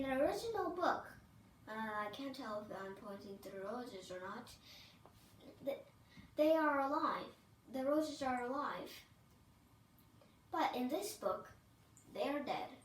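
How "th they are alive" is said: usual speaking voice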